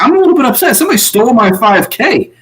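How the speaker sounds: high, pouty mock complaint; mock whiny Danny. Mimicking a houseguest upset over split prize, high pout.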